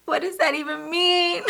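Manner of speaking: laughing at the baffling answer. Real, not acted. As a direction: scoff through a laugh. Laughing retort to God's reply.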